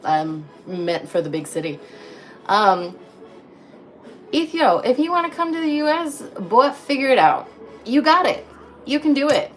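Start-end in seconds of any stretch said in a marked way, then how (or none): 4.3-9.5 encouraging. Real, not acted. Encouraging a viewer.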